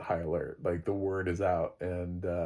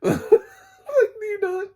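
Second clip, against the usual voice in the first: high squealing laugh through the words; giggling in disbelief; 8 dB louder, much slower than usual. Real, not acted. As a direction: crack up mid-question. Laughing at the dumb orc.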